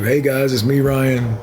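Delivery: usual speaking voice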